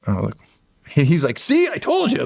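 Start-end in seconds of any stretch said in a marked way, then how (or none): 1.4-2.3 very high, smug little demon. Retold or imitated. Demon Dart gloats at the narrator, very high voice. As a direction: gloat like a tiny cheeky demon. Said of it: "Dart, 'cause he's, like, cracking jokes".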